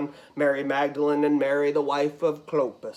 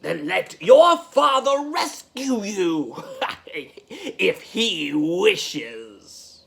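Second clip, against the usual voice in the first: higher-pitched taunt with a scornful laugh; mocking Annas; 3 semitones higher, slower than usual. Annas challenging Jesus to be rescued, jeering. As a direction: goad him, voice lifted, then drop for the last words. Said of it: "Annas adds".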